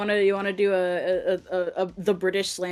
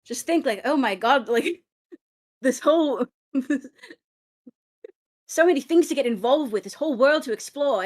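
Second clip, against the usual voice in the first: higher, projected British ranter's voice; British accent impression; 5 semitones higher than usual. Friend performing the pronouns rant on request, British accent. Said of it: "your best British accent".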